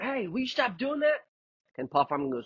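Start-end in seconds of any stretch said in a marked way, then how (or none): 0.0-1.2 imitating background yell. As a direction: snap at someone off-mic, hammed-up. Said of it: "yelling in the background".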